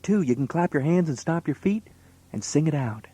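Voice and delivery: ordinary delivery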